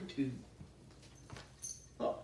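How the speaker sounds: ordinary delivery, nothing marked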